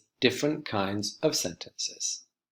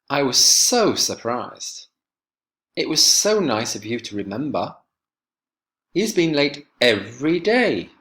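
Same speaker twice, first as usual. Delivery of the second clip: louder, emphatic exclamations; exclaiming; 3 semitones higher, 5 dB louder than usual. Shown as a demonstration. Teacher demonstrating exclamatory sentences to her class, loud and emphatic. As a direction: punch the key words like a surprised exclamation. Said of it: "The voice also changes and we emphasize some of the words. We make them sound louder than normal".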